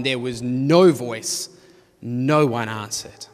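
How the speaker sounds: slow, low, heavy stress on each "no"; emphatic negatives. Shown as a demonstration. Preacher shows the narrator emphasizing silence, slow stress on each NO. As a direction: punctuate both phrases on "no", pausing between them. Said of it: "emphasizes this silence with two emphatic negatives".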